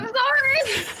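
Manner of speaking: high, loud, laughing; laughing apology. Real, not acted. Player apologising at the table mid-laugh, high laughing voice. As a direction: squeal it out through laughter.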